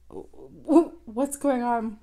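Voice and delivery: high, loud stuttering bafflement; stammer, lost and confused. Retold or imitated.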